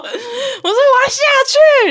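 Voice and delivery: slow, high, drawn-out wail half laughing half crying; wailing shout. Retold or imitated. Rider collapsing and wailing to get off, slow laughing cry. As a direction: wail it like you are falling apart. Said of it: "然后我说".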